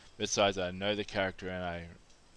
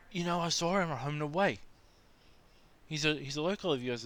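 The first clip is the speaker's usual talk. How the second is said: high, earnest American tourist voice; a clueless tourist sure he is right; high, eager insisting; insistent tourist; 6 semitones higher than usual. Retold or imitated.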